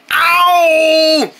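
pained ow. Acted. Foot massage hurts.

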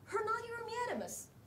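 high mock English lilt on the phrase; English-sounding. Shown as a demonstration. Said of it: "英語っぽく言って".

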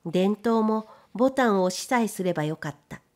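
ordinary delivery, nothing marked

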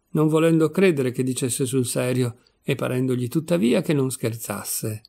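ordinary delivery, nothing marked

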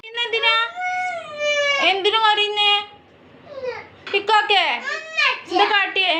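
mother calling out to her crying little one, high and loud. Real, not acted. Mother calls to crying baby.

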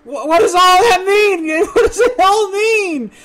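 high, loud, slow half-sobbing wail; wailing in overwhelmed despair; real comic panic. Real, not acted. Overwhelmed by screens of information.

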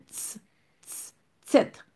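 T, t as voiceless ts bursts, then tèt; careful pronunciation. Shown as a demonstration. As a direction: build tèt up from its ts sound. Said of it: "m vle nou pwononse li byen". (T, t, epi tèt, byen pwononse)

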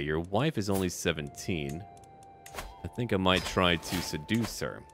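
normal talking voice

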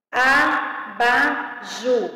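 very slow "Abajur", a-ba-jur in separate beats; syllable by syllable. Shown as a demonstration. Teacher separating words for pupils, syllable by syllable. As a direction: count out each syllable of "Abajur". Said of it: "separação de palavras e sílabas".

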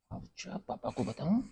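restrained threat with rising end; threatening question. Acted. Parent threatening Adi with papa, restrained menace. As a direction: dangle the threat, lifting at the end.

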